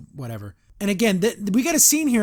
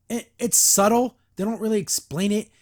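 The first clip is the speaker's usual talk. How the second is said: normal talking voice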